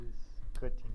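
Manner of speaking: choppy distorted connection; breaking up over a bad call; glitching audio. Real, not acted. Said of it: "your audio is cutting".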